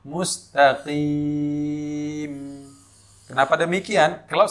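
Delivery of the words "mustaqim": slow Mustaqim, m humming on at the stop; drawn-out Mustaqim with humming m; flowing final mim. Shown as a demonstration. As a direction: let the final m of Mustaqim flow. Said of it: "suara sedikit mengalir".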